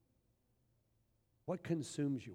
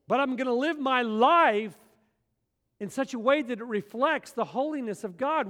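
full-force preaching; 8 semitones higher, 11 dB louder than usual. Acted. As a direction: thunder it from the pulpit. Preaching climax, loud and high.

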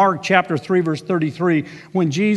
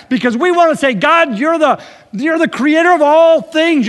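fervent preaching; 9 semitones higher, 5 dB louder than usual. Acted. Preaching at full force.

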